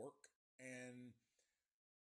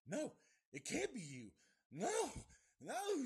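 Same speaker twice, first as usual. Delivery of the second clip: panicked cry rising much higher and louder; terrified denial; 11 semitones higher, 8 dB louder than usual. Retold or imitated. Girl seeing the killer alive, terrified shriek. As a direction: shriek the no's in horror. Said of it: "She freaks the fuck out".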